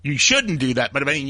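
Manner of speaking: ordinary talk, nothing marked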